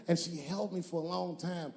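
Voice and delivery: normal talking voice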